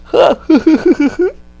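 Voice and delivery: high, loud imitated cackle; manic laugh. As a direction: break into the Joker's helpless laugh. Imitating the Joker's laugh again.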